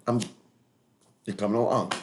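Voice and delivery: ordinary talk, nothing marked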